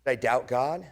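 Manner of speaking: usual speaking voice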